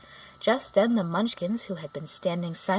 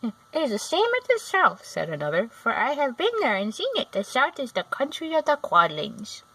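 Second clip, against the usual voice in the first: higher, louder little man; Munchkin voice; 5 semitones higher, 4 dB louder than usual. A narrator's reading. A Munchkin warns Dorothy of the desert, high and little. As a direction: pipe it up gravely.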